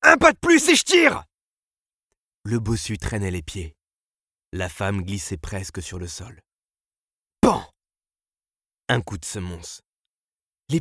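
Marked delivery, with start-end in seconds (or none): 0.0-1.2 high, very loud warning yell; desperate full-voiced threat; shouted threat. A narrator's reading. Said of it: "cria le directeur". 7.4-7.7 loud, sudden bang; abrupt vocal gun crack; imitated gunshot. A narrator's reading. Narrator imitates a warning gunshot.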